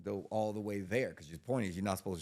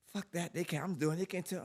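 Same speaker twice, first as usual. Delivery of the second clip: much higher put-on girlfriend voice; girlfriend voiced; 12 semitones higher, 4 dB louder than usual. Girlfriend refusing to stop selling, higher put-on voice. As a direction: play her stubborn and shrill-pitched. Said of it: "her motherfucking ass".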